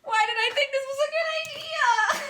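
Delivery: high-pitched, wailing whine; comic tearful squeal; mock crying. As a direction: wail it like a hammed-up meltdown. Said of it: "I'm gonna cry".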